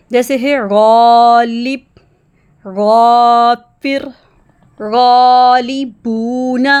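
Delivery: غالب and غافر slow, heavy alif after غین. Shown as a demonstration. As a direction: recite with a thick, drawn alif. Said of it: "الف بھی موٹا سنائی دے گا". (غین کے ساتھ موٹا الف)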